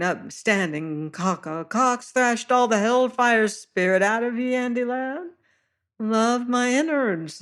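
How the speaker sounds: high fluting sailor, mocking; high-pitched voice. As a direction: tease the beaten man in a high voice. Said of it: "high-pitched voice".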